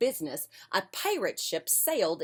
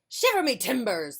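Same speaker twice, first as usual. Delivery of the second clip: pirate exclamation; 5 semitones higher, 5 dB louder than usual. Acted. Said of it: "the pirate said".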